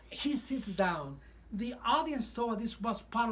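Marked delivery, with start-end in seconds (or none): none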